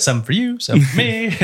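high singsong. Pretend. Joking about keeping the drugs.

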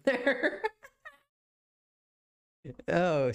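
laughing while telling it; real laughter. Real, not acted. Podcaster telling how the fight ended, laughing. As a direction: break into laughs as you talk. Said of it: "that's why I'm laughing right now".

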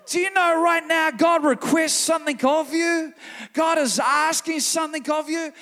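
loud, high, fervent preaching; preaching at full force. Acted. Pastor rallying congregation, loud fervent preaching. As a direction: build it up and call them to purpose.

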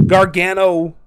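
ordinary talk, nothing marked

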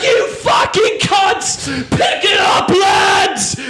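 high, slow, shouted football rage; British woman screaming. Retold or imitated. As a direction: scream at the telly during the World Cup. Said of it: "screaming at the T V".

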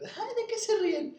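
high, slow, sing-song mock of the friend. Friend's voice acted in anecdote. (voz aguda y burlona imitando a la amiga)

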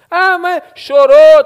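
loud, high, drawn-out protest; exaggerated objection, pitched high; mock protest. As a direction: wail the objection slowly and loudly. Voicing an objection in retold confrontation.

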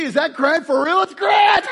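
high, loud delighted yell; thrilled shout into the room; excited shout. Real, not acted. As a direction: cheer a friend found in the crowd. Spots the loud winner.

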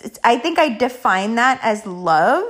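usual speaking voice

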